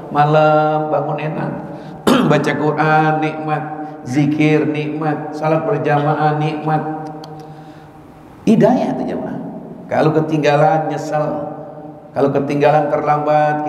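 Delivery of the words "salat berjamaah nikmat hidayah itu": normal talking voice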